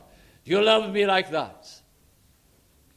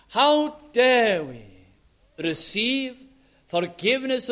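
sermon rebuke; 4 semitones lower, slower than usual. Real, not acted. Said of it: "preacher's slowing, fading rebuke".

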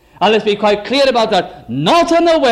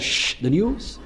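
hushed shushing for the news; 8 dB quieter, slower than usual. Retold or imitated. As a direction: hush the room so the news can be heard. Hushing family for the news.

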